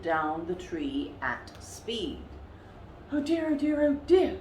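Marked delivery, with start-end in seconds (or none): 3.1-4.4 high, fretting polar bear voice; a flustered big bear fussing to herself; fretful muttering. A narrator's reading. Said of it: "she muttered to herself".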